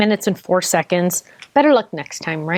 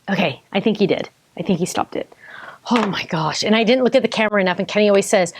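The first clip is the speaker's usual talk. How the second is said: ordinary talk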